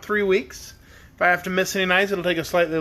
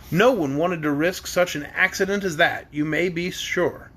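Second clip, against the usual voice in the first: ordinary delivery, nothing marked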